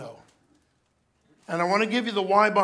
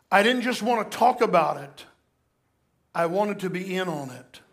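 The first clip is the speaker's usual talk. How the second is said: scripture reading; 3 semitones higher, 3 dB louder than usual. Acted. Reads scripture aloud.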